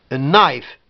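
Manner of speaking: "knife" as nife, k dropped; silent k. Shown as a demonstration. Teacher showing K is not pronounced, n onset. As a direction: say "knife" starting straight on n. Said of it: "le que, il n'est pas prononcé".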